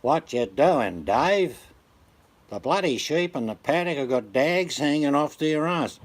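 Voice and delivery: put-on old radio-serial Aussie country character; Dad from Dad and Dave. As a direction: act a bush dad calling to his son. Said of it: "he'd be like this".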